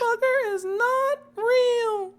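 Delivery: very high, slow, cracking shriek; high squeal. Retold or imitated. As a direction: shriek it high until the voice breaks. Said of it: "the pitch you hit was".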